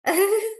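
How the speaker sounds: short high, loud laugh. Real, not acted. Teacher tickled by a student's question, laughing. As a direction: burst out with a quick laugh.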